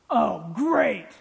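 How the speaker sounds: loud, sarcastic groan of dismay; acted disgust at unwelcome news; imitating a fed-up scoffer; exasperated. Co-worker's reaction to conversion news.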